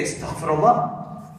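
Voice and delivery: clutch-your-pearls mock horror at the word haram; mock scandalized outrage. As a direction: mimic a scandalized judge. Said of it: "we would hear the story and say".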